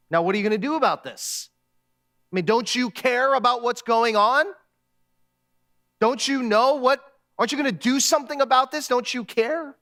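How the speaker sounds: acted exasperated demand; indignant challenge. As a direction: press God for answers, pitch climbing. Gideon's inner monologue challenging God.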